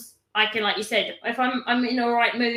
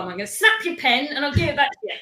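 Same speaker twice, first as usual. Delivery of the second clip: ordinary talk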